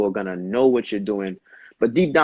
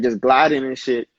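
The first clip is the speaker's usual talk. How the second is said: normal talking voice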